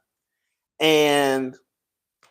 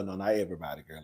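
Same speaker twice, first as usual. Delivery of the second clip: ordinary talk, nothing marked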